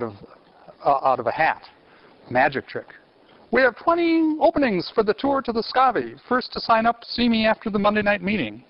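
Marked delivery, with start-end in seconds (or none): 3.5-8.6 higher announcer voice for the Monsignor; imitating the Monsignor. Teacher mimicking Monsignor's tour announcement, higher voice. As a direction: mimic a priest's parish announcement, pitched up. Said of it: "Everything's there but Monsignor's accent".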